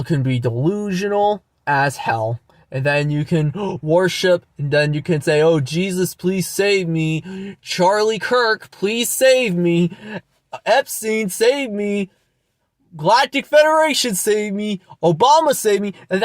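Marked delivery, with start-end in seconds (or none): 5.4-15.9 mocking pleas. Vlogger imitating believers begging false gods, mocking. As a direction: plead in parody to one saviour after another. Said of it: "I'm mocking your religion".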